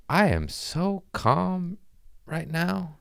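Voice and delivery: high, slow dreamy voice; spacey calm. Retold or imitated. Recalling his first stage set on herbs, high and dreamy. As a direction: float it like you're sedated. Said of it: "and I was just like".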